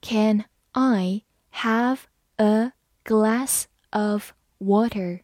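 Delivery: very slow, word by word; slow reading. Shown as a demonstration. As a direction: read it slowly, separating each word. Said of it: "好，慢读一遍". (慢读，逐词分开)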